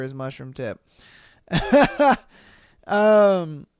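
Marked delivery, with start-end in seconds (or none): 1.5-2.2 laugh. Real, not acted. Laughing at the sock line.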